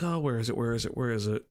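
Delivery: low, softening mutter while searching; muttering to self. Real, not acted. Streamer hunting for a quote, muttering to self.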